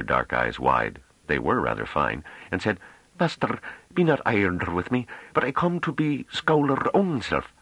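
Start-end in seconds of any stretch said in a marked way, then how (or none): 3.2-7.5 put-on high, pleading voice of a rustic young woman; a plain country girl begging to be taken seriously; young Hamish woman, high and earnest; earnest farm girl. A narrator's reading. Novi pleads her wish.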